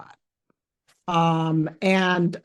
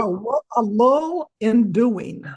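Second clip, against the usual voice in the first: "a lull in doing" said slowly, each word drawn out; slow, careful repeat; 3 semitones higher, slower than usual. Real, not acted. Asked to say the phrase again.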